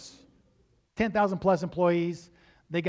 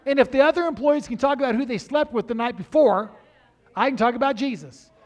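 defiant retort; 7 semitones higher, 10 dB louder than usual. Retold or imitated. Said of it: "I said".

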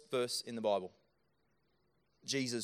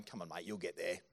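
lower, offhand brush-off; a careless friend brushing off someone crying, lower voice; dismissive mate; 6 semitones lower, 4 dB quieter than usual. Pretend. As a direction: toss it off like an impatient mate. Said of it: "walking along going".

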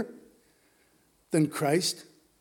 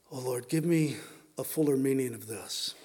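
low, softened plea to God; humble prayer; 6 semitones lower, 5 dB quieter, faster than usual. Acted. Disciple asks the Lord for understanding, quiet prayer. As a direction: pray it quietly, lower than preaching.

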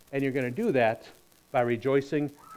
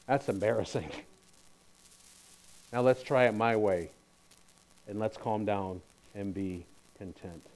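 low, softening to a quiet calm; a gentle divine rebuke fading to hush; lightly voiced God, quiet and calm; 5 semitones lower, 6 dB quieter than usual. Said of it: "it really was God who said".

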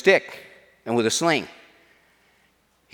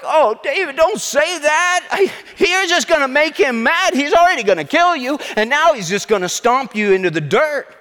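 hammed-up alarm, pitched way up; panicked pleading; 9 semitones higher than usual. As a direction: plead with him in a high, worried voice. Acted panic of the Israelites.

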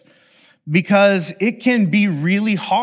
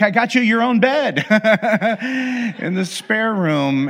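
laughing giver; 5 semitones higher than usual. Retold or imitated. Giver voiced while laughing.

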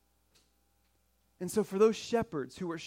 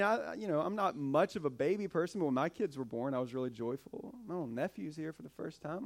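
normal talking voice

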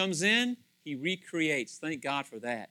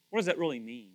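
ordinary talk, nothing marked

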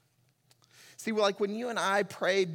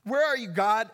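desperate call; 6 semitones higher, 5 dB louder than usual. Acted. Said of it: "screaming out to God".

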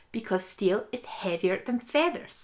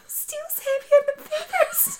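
crying shout. Retold or imitated. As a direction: wail it as if in tears. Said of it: "he's, like, crying".

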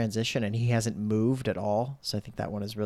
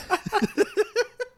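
high laugh. Real, not acted. Laughing at the quoted line.

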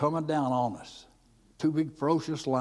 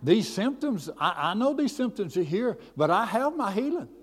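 higher-pitched, insistent retold testimony; mildly acted defiance, standing on faith; defiant declaration; 6 semitones higher than usual. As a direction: declare it firmly, voice lifted on each claim.

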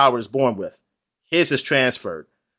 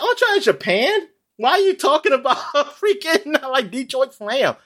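incredulous outburst; 10 semitones higher than usual. Retold or imitated. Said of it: "I said".

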